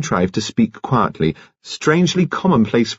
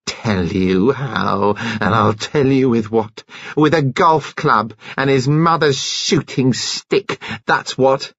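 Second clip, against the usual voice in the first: higher, slow, gloating fury; mild acted rage; 5 semitones higher than usual. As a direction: savour each word of the accusation in rage. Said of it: "She was beside herself with rage".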